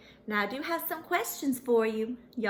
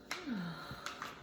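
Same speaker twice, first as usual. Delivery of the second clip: long low sigh; sad sigh; 7 semitones lower, 12 dB quieter than usual. Acted. Lonely pony sighing to kids, soft and low. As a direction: sigh it out, deflated.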